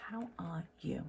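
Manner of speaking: soft playful whisper; a quiet whispered repeat of the greeting. Pretend. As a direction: whisper the question to the viewer. Greeting viewers, then whispers.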